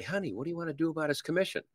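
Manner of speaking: wife asking husband in a hushed rush; acted spouse. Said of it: "husband and wife sneak away".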